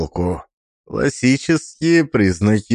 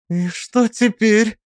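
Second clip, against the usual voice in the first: much higher, lightly acted weak voice; 11 semitones higher than usual. A narrator's reading. Alisa asks what happens next, still shaken, weak voice. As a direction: voice a drained young woman's question, pitched high. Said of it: "её голос всё ещё был слабым".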